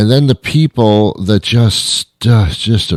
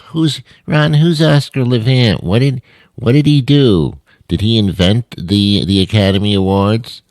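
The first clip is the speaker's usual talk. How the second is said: put-on higher, airheaded young voice asking Ron; mock millennial; 4 semitones higher than usual. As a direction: play a clueless youngster quizzing the host.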